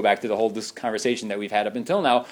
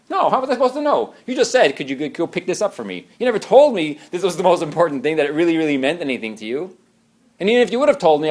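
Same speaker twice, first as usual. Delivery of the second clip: higher, louder indignant protest; defensive spouse; 4 semitones higher, 5 dB louder, faster than usual. Retold or imitated. Preacher voicing a defensive spouse, indignant and loud. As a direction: push back like a defensive husband.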